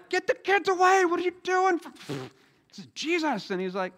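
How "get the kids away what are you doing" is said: high, loud scolding voice; shooing disciples. Retold or imitated. Disciples scolding children coming up to Jesus, high indignant shout.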